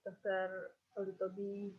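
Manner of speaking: faint, far from the mic; speaking too far from the microphone; barely audible. Real, not acted. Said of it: "Your voice is very faint".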